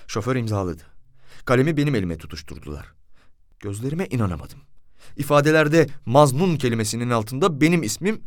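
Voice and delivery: plain reading voice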